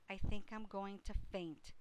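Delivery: ordinary delivery, nothing marked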